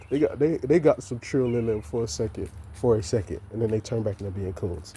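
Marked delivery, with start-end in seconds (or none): none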